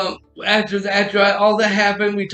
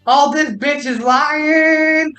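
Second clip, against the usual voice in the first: incredulous; 4 semitones higher, slower than usual. Retold or imitated. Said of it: "she's like".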